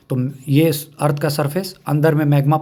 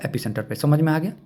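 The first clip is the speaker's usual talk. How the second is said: ordinary talk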